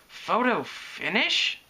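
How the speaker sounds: high, slow, rising question; puzzled question. A narrator's reading. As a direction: ask it incredulously, lifting the end. Puzzled question on seeing photographer.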